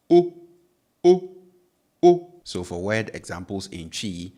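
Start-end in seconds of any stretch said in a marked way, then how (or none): 0.1-2.3 O held loud and high, no glide; pure O. Shown as a demonstration. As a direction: belt a single steady O.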